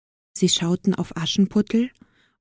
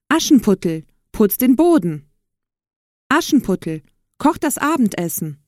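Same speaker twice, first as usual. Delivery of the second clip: calling out orders; 3 semitones higher than usual. A narrator's reading. Stepfamily ordering Aschenputtel to clean and cook, calling out. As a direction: call out chores, lightly acted. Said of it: "riefen die Stiefmutter und Stiefschwestern".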